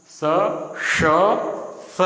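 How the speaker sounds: "स, श, स" as three separate sibilant sounds; distinct sibilants. Shown as a demonstration. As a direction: give "स", "श", "स" each its own tongue position. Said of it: "तीनों का अलग-अलग उच्चारण करो आप". ("स, श, स" को अलग-अलग उच्चारण में बोलें)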